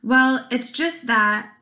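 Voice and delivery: hesitant, drawn-out start; mildly nervous kid, slow to begin. A narrator's reading.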